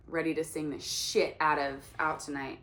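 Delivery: usual speaking voice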